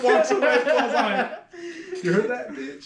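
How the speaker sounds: high-pitched words through real laughter; cracking up while gloating; laughing. Real, not acted. Barber telling friend of the walk-off, laughing gleefully.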